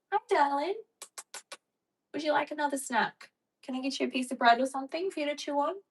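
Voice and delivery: high, doting pet voice; pet talk. Real, not acted. Owner offering her pet a snack, high doting voice.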